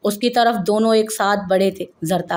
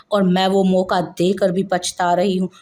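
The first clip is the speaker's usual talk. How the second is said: ordinary delivery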